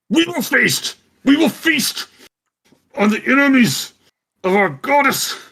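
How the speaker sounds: high, loud monster shriek; roaring beast. Acted. Cerberus head threatens the enemies of its goddess, shrieking. Game master voices right-hand head.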